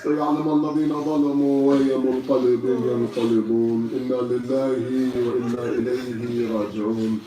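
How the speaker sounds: low, subdued Arabic prayer; closing recitation. Acted. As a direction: drop right down and murmur the closing verse.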